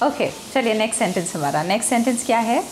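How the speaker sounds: ordinary talk, nothing marked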